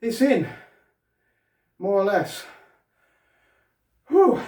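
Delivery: breathless, high and loud relief; puffing after the heavy lifting; out of breath. Real, not acted. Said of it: "I'm a little bit out of breath".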